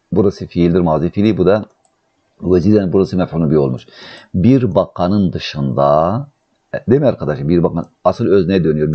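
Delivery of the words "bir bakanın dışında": very slow, drawn-out word endings; teacher's slow, measured translation; slow dictation. Shown as a demonstration.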